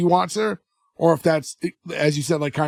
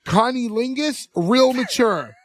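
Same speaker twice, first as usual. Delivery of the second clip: dry, slow, higher mock deadpan; imitating Dolores; 7 semitones higher, slower than usual. Dolores at the desk mocks the name, dry. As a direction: drawl it out like an unimpressed clerk. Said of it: "hear Dolores at the desk go".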